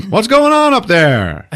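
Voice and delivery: high, slow, loud mock holler; yelling upstairs. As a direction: call up to the floor above. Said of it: "looks up and yells".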